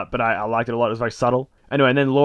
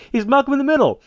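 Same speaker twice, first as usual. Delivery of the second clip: very high exclamation, falling at the end; mock excited kid; 13 semitones higher, 4 dB louder than usual. Retelling the kid recognising Frankie, mock excited. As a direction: blurt it like a hammed-up young fan.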